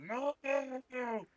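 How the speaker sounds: high loud fleeing cry; scared ogre crying out; panicked no. Acted. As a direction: wail it while running away. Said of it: "turns around and runs".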